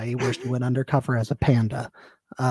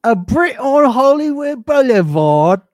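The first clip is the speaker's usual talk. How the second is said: high, louder, slow hammed-up British voice; mock British accent; 8 semitones higher, 8 dB louder than usual. Cohost keeps the British bit going, slow, high mock British voice. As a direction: ham up a cheeky Brit.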